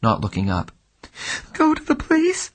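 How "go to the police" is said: choked begging. Acted. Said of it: "she begged in a small, choked voice".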